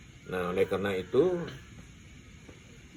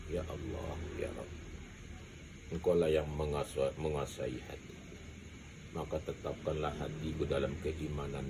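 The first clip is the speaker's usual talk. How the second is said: soft, low, devout prayer voice; quiet prayer; 4 semitones lower, 7 dB quieter than usual. Shown as a demonstration. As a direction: pray humbly under the breath. Models a husband's prayer. (doa lirih penuh khusyuk)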